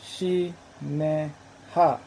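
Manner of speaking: Shi-ne-ha very slowly, each syllable standing apart; reconstructed pronunciation. Shown as a demonstration. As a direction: sound out Shi-ne-ha as three separate, drawn-out syllables. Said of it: "been pronounced similar to".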